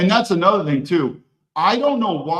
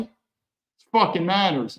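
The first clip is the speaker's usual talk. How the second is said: ordinary talk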